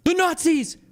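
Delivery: high mock-excited yell; hammed-up excitement. Streamer calling out the awaited answer, hammed-up excitement. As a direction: burst out high with mock delight. Excited outburst at expected word.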